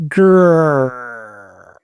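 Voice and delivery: low mock tiger growl. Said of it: "Give us your best tiger roar".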